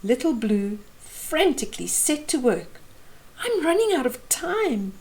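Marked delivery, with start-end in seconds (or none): 3.4-4.9 panicky. A narrator's reading. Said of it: "she thought in a panic".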